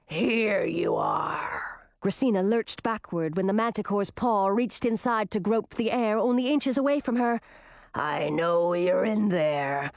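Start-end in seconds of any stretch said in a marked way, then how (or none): 0.1-1.7 low, very slow monster voice; menacing manticore. A narrator's reading. Manticore finds the children's hole, slow menace. 7.9-9.9 low, drawn-out monster voice; menacing manticore. A narrator's reading. Manticore outside their hiding hole, low slow taunt.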